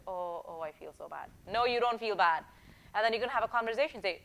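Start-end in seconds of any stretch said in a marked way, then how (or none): 0.0-1.3 soft, sinking mock self-pity; quietly sorry for yourself, put on; mock guilty. Coach imitating someone taking the blame, mock guilty.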